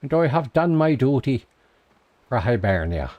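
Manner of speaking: mock Irish accent declaring duty done for Hibernia; bad Irish accent. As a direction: ham up a proud Irish brogue, badly. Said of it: "In a terrible attempt at an accent".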